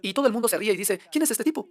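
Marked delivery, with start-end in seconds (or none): 1.1-1.7 mocking passenger. Mocking crowd voiced on ship.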